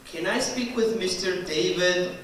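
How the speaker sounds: normal talking voice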